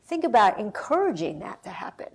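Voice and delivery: ordinary talk, nothing marked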